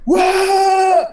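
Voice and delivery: high, loud, drawn-out whoa; imitated crowd yell. Retelling a Nigerian audience's reaction to friends, high excited yell. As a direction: yell like a roaring cinema crowd. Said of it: "people start yelling".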